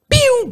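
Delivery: high falling "pew" of a bike shooting off; quick, high-pitched imitated whoosh; imitated zoom. Rider tells how the first bike sped away, imitated zoom.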